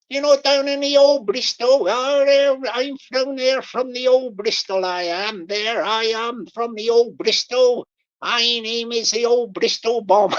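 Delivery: hammed-up old Bristol local; mock West Country Bristol accent. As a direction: put on a loud Bristol burr. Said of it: "he'll be talking like that".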